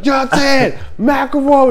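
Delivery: high, loud roll call; imitated calling out. Soldier summoning his buddies, imitated holler. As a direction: holler for backup in a mock voice.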